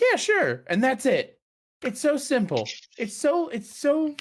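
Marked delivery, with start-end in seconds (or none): 0.0-0.6 high, loud breezy "sure"; mock casual reply. Dance teacher casually agreeing to teach, breezy mock voice. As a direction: toss it off like an easygoing dance teacher. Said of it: "It's like".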